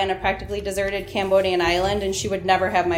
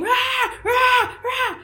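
men screaming; much slower than usual. Retold or imitated. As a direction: bark it like angry men on the beach.

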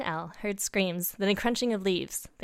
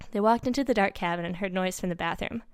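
ordinary delivery

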